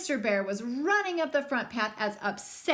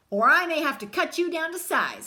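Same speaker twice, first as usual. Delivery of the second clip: threatening; 5 semitones higher, 7 dB louder than usual. Acted. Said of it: "says Brother Bear".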